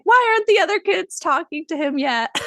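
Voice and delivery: high, loud, whiny plaint; mock sad wail. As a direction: wail it in exaggerated heartbreak. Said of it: "I just felt sad".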